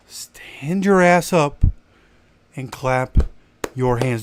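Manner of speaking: slow, breathy stage whisper; whispered plea to the crowd; urgent whisper. Acted. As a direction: hiss it out slowly for emphasis. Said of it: "urging fans to clap".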